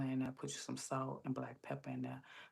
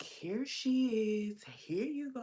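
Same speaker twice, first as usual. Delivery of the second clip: high, louder mimicked greeting; drawn-out greeting; 8 semitones higher, 5 dB louder, slower than usual. Pantry lady welcoming her in line, drawn-out. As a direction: sing out a slow, stretched welcome.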